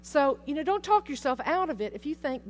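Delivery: ordinary delivery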